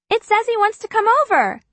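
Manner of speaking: a hint of a worried teen. Narrator's own character speaks up.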